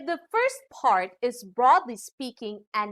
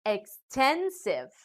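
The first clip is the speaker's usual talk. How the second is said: "extensive" slowly, weight on TEN; stress before ending. Shown as a demonstration. Teacher showing ending iv, "extensive" slowly stressed on TEN. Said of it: "the syllable before these endings".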